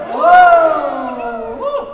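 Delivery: loud high whoop; excited cheer. Real, not acted. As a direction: whoop at the big bid.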